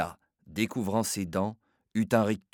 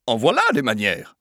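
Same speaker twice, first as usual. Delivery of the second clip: loud, sour indignant lady; angry mother scolding at the barrier, sour; 3 semitones higher, 7 dB louder than usual. Acted. Indignant lady in a sour tone. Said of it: "d'un ton aigre".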